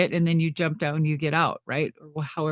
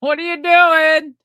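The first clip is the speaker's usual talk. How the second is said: high, loud, drawn-out mock panic; imitated alarmed outcry; 9 semitones higher, 7 dB louder, slower than usual. Sting reacting to what was not planned, imitated alarmed outcry. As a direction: push it up high and loud, half laughing. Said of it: "that was a legit".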